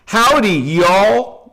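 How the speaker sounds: playful country-accent greeting, "y'all" run together. Shown as a demonstration. Demonstrating slang to viewers in a country accent. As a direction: put on a light country twang. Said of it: "you say it with the country, country accent".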